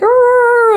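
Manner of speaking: high, loud yowl; mock dog howl. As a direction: let out a howling dog noise. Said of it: "They're like".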